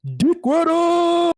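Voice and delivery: very high, loud, drawn-out holler; stretched high-pitched jeer; mock heckling shout. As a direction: yell it like a playful taunt. Mock heckle at a flattering comment.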